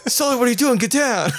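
high-pitched urgent call; playfully panicked warning to a partner; urgent and high, half laughing; mock alarm. Mock panic at Sully in game.

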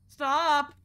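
loud, sudden stop; sharp yell. Real, not acted. Streamer yelling at the game, sharp yell. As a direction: bark it at the screen.

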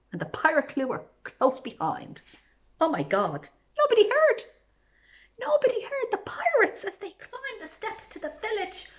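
ordinary delivery

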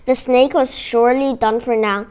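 ordinary delivery, nothing marked

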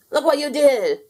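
a blobby upset at the dented spaceship. Acted. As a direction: accuse a friend who broke it. Spaceship comes back damaged.

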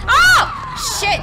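high, loud startled cry; real startled swearing; startled yell. Real, not acted. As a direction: blurt it out in sudden shock. Startled yell in zombie game.

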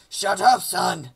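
loud mock scolding; cross dad. Dad silencing his pleading son, mock-angry shout. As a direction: shut the kid down with a loud burst.

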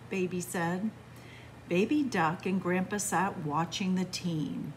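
usual speaking voice

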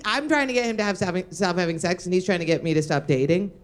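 ordinary delivery

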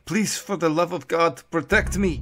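put-on high, loud plea in a thick German accent. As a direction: beg desperately with a heavy German accent. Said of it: "said in thickly accented English".

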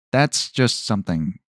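normal talking voice

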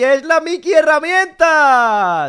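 Mickey-style announcement. Acted. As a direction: announce it like a kids' show reveal. Presenta la herramienta como Mickey. (anunciando la Mickey herramienta muy alto y lento)

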